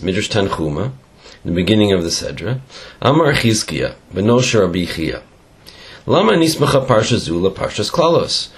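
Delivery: ordinary talk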